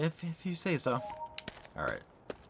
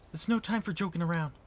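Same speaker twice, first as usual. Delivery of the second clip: ordinary talk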